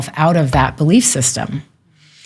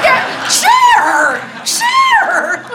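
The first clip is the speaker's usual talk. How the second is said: very high, hammed-up cry of recognition; mock jubilant relief; much slower than usual. Guest retells finally getting her lost word, mock jubilant cry. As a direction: squeal the found word in comic triumph. Acts out joy at finding word.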